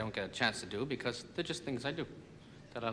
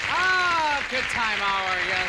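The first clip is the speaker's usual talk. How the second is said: [name] voice; 9 semitones higher, 9 dB louder, much slower than usual. Acted. Glenn's voice echoing show name.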